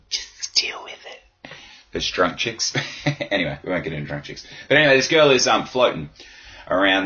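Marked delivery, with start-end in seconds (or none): 0.1-1.5 slow breathy whisper. Shown as a demonstration. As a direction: hush it out slowly. Repeats the line, softened.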